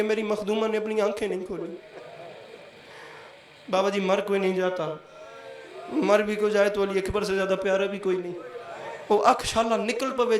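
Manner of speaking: quiet majlis narration with long pauses; subdued sermon narration. Acted. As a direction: tell the sacred story hushed and grieving. Preacher drops into subdued narration.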